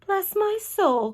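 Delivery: high, slow exclamation; astonished cry. A narrator's reading. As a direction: draw it out in amazement. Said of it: "cried the head of the fire department".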